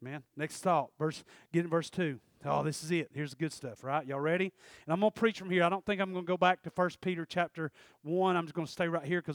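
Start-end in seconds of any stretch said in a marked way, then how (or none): none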